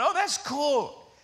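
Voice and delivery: put-on high, drawn-out kid's approval; pleased kids. Children pleased about Grandma visiting.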